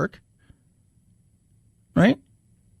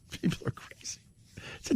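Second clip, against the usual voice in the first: whispered under the breath, far softer; whispered aside; 17 dB quieter than usual. Real, not acted. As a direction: murmur it aside to the listeners.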